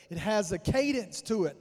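ordinary talk, nothing marked

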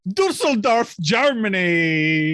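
slow, drawn-out triumphant announcement; excited reveal. Real, not acted. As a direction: stretch out the big reveal. Big reveal of listeners' location.

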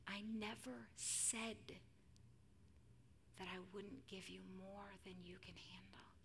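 slow near-whisper of comfort; hushed, halting divine reassurance; tender beckoning. Acted. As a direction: beckon a tired child gently, pausing between words. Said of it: "Jesus beckons".

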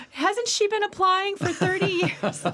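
high, loud mock disbelief; incredulous colleagues. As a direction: play the puzzled hiring committee. Imitating incredulous hiring folks.

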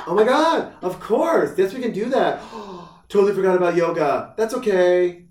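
high, loud mock ditzy wife; hyper airhead. Imitating a hyper young wife who forgot yoga, giddy and airheaded. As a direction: gush it with a gasp, scatterbrained. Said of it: "super hyper".

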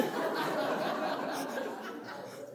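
a soft, airy laugh at her own story; breathy laugh. Real, not acted. She laughs while telling the congregation about waking her husband. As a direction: laugh it off, amused at herself.

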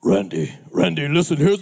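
very low, deep pastor voice; German pastor's voice. Retold or imitated. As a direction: rumble like a big old German pastor. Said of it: "had a voice like this".

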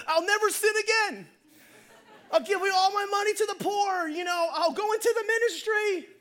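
high, loud hammed-up vows; desperate bargaining. Hanging man makes God wild promises, high hammed-up desperation. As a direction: promise anything at a frantic pitch.

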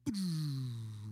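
loud whirr winding down; a long, loud mechanical whir that dies away; imitated motor whine. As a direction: mimic a motor losing power. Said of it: "the motor just makes a sound".

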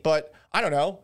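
usual speaking voice

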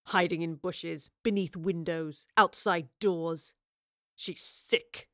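slow, low growling accusation; low, menacing contempt; growled. A narrator's reading. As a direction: list each offence slowly, with disgust. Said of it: "Rivers growled".

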